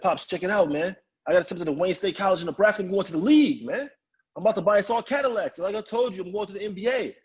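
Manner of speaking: high, hyped-up announcement; acted-out teenage big dreams; excited bragging. Brags excitedly to his dad.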